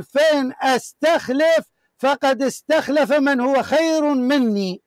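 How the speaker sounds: every word drawn out slowly to weigh it; slow, deliberate recitation of a quoted text; slow emphatic reading. Acted. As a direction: read the quote slowly so each word lands.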